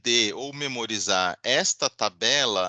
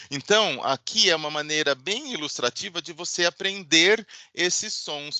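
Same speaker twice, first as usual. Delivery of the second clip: ordinary delivery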